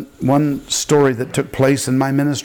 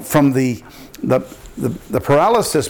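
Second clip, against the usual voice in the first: normal talking voice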